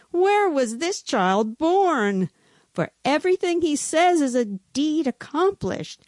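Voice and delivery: high, theatrical wondering; astonished onlookers. A narrator's reading. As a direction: ask it in mock-dramatic amazement. Astonished onlookers quoted in story.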